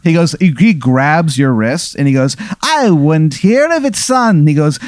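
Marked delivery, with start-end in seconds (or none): 2.6-4.5 high-pitched hammed-up Irish accent; Easy Irish. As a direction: insist in a terrible, high Irish brogue. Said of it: "this terrible Irish accent".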